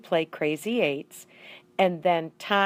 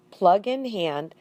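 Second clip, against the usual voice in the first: ordinary delivery, nothing marked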